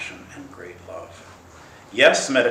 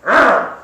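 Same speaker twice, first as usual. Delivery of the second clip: loud, high grunt of frustration; exasperated growl; 13 semitones higher, 15 dB louder than usual. Acted. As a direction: blurt out a frustrated urgh. Mind's frustration boils over.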